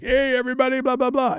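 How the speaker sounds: high, breezy mock announcer voice over the plane mic; mock flight announcement. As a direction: ham up a cheery welcome aboard. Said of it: "he got on the mic and was like".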